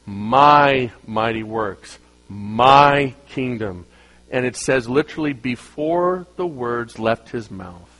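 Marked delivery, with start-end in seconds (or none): 0.1-3.9 very slow, lower boast; proud king. Retold or imitated. Nebuchadnezzar boasting of his kingdom, slow and low. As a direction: savor each word like a proud king. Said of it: "But he says".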